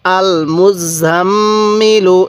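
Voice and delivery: "আল মুজাম্মিলু" very slow, double m hummed long and level; ghunnah. Shown as a demonstration. Teacher modelling the whole word for learners, long nasal hold. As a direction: hold the nasal mm in "আল মুজাম্মিলু", then release into "ilu". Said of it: "এরকমভাবে শিখব".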